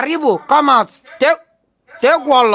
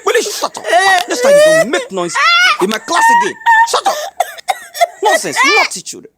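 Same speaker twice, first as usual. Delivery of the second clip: much higher, squeaky scolding schoolteacher; scolding teacher; 13 semitones higher, faster than usual. Acted. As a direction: scold the class in a shrill teacher voice.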